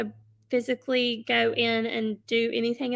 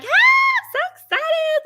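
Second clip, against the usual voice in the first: much higher, louder, slow gush; gushing excitement; 8 dB louder, slower than usual. Shown as a demonstration. Seller demos an excited live host. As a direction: squeal with delight for the viewers. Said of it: "Do not be monotone".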